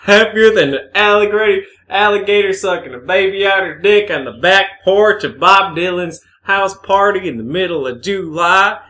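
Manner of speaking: high, loud put-on storyteller; hammed-up southern simile. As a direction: belt out a mock folksy comparison. Mocking convoluted southern similes.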